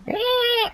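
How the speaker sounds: very high imitated squeal; high mock shriek; mock screaming. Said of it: "starts, like, screaming".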